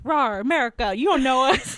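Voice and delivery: loud hammed-up growl at America; mock roar. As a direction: roar it like a cartoon monster, mockingly.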